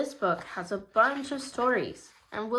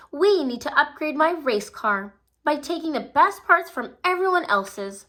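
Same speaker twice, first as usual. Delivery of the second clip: higher, louder scheming villain; scheming racer; 6 semitones higher, 4 dB louder than usual. A narrator's reading. The cheetah plotting to steal parts, scheming. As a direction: plot it out like a cheat.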